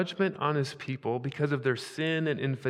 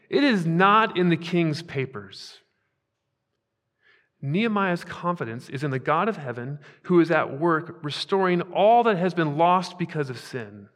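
louder, emphatic repeated "not"; building sermon; 3 semitones higher, 5 dB louder than usual. Acted. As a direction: build each line to a firm close. Preaching crescendo.

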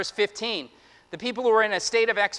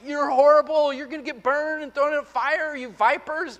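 in-your-face rant; 5 semitones higher than usual. Retold or imitated. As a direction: get right in their face, pitched up. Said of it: "in your face like".

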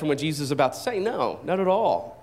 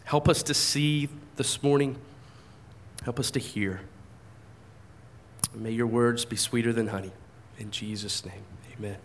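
soft, lowered prayer voice; 7 semitones lower, 6 dB quieter than usual. Real, not acted. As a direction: pray quietly and reverently. Opening prayer before the sermon.

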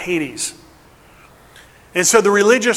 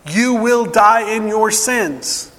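preaching at full force; 4 semitones higher, slower than usual. Acted. Preacher voicing Jesus' warning to the crowd, emphatic. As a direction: proclaim each line like a verdict.

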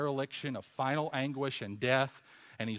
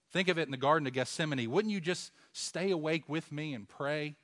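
ordinary talk, nothing marked

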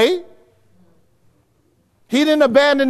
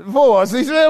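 ordinary talk

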